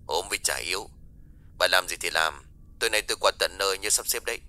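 soft, distant male caller on the line; muted telephone voice, casual request; voice on phone. Acted. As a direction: speak as the man at the other end of a call. Same caller dismissing excuse.

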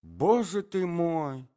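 high, slow lament; drawn-out helpless exclamation; poor prince. A narrator's reading. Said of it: "говорил бедный князь".

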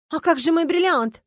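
high, loud, impatient demand; indignant outburst. A narrator's reading. Starkova breaking in on the police about her diamond, indignant. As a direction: burst out, unable to hold it in. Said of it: "не выдержала госпожа Старкова".

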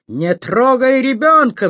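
high, loud, drawn-out cry of fury; acted outraged shout in a changed voice; flaring up. A narrator's reading. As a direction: protest at full pitch, stretching the words. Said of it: "взвилась Аня".

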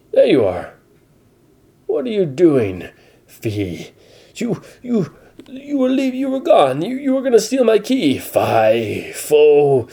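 high, squeaky, panicked babble; frantic squeaky voice. Acted. Pedro panicking over the stolen key, high squeaky voice. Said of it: "said Pedro".

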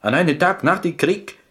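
his voice carries too far as he tells it; too loud. Acted.

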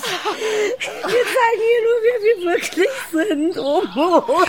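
high, slow, laugh breaking into crying; laughing-crying hysteria. Acted. Woman after the brawl laughs and cries at once. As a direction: fall apart between laughing and crying.

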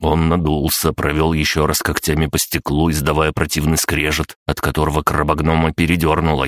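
plain reading voice

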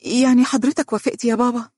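high, hopeful question; tearfully happy anticipation; eager. A narrator's reading. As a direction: ask breathlessly eager for a yes. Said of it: "وهي تقول بلهفة".

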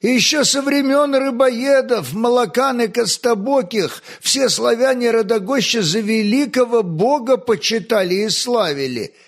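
high-pitched objection; exclaiming. A narrator's reading. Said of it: "воскликнул крючконосый Волхв".

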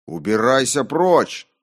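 loud, high command to be gone; shouting. A narrator's reading. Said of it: "закричал я".

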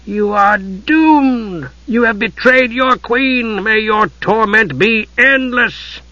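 high, slow snarling curse. A narrator's reading.

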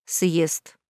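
slow, sullen confirmation; gloomy. A narrator's reading. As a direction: say it darkly and slowly. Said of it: "хмуро подтвердил нарцисс".

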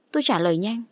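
ordinary delivery, nothing marked

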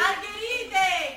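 long drawn-out vendor cry; stretching "Margherite!" out very slowly; street vendor call. Acted. Flower seller calling out wares.

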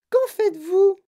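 put-on piping, very high child's voice; Veruca Salt astonished. A narrator's reading. Girl questioning Wonka about whips, astonished. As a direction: ask loudly, high and amazed. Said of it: "s'étonna Veruca Salt".

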